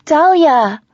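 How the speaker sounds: loud, high call from a distance. A narrator's reading. Friend spots visitor from the balcony, calling out loudly. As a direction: call her name out from upstairs.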